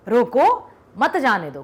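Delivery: "रोको" set apart by a pause; pause after रोको. Shown as a demonstration. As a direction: slowly, break after "रोको", falling on "मत जाने दो". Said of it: "यहां पर था तो क्या था".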